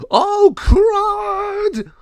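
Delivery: very high, loud drawn-out yell; hammed-up cartoon scream of alarm; panicked shriek. El Jago seeing the giant raven, panicked.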